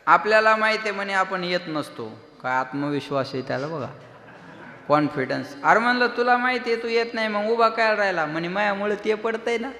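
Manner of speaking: loud, ringing kirtan sermon voice; a kirtankar thundering at the gathering; full-force preaching. Acted. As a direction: preach it out to the whole crowd.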